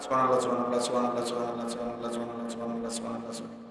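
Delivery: "সুবহানাল্লাহ" gabbled in a run, syllables swallowed; rushed tasbih. Shown as a demonstration. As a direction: rattle off "সুবহানাল্লাহ" fast and slurred, fading away. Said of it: "এগুলা কোনো তাসবিহ না". ("সুবহানাল্লাহ" তাড়াহুড়ো করে অস্পষ্টভাবে একটানা বলা)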